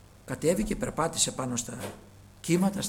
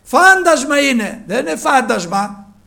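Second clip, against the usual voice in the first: preaching at full force; 8 semitones higher, 14 dB louder than usual. Acted. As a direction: proclaim it to the whole church. (κήρυγμα με δύναμη, δυνατά)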